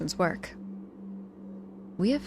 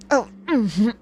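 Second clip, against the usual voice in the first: pained grunt; 4 semitones higher, 9 dB louder than usual. Acted. Squeezed in painful hug.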